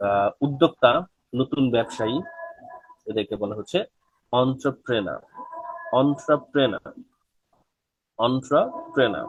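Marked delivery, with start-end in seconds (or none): 4.3-9.3 এন্টারপ্রেনার as "ontra-prena", falling; এন্টারপ্রেনার starting "on", no final r; model pronunciation. Shown as a demonstration. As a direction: drill এন্টারপ্রেনার with pauses between takes. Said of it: "এটার উচ্চারণ আসলে হচ্ছে".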